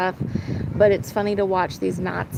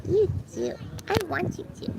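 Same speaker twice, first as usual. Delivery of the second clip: much higher, soft cooing pet voice; 11 semitones higher, 5 dB quieter than usual. Real, not acted. To her pet bird, urging it closer, high cooing baby talk.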